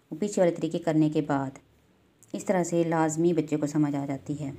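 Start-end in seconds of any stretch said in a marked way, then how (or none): none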